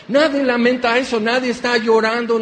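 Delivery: ordinary delivery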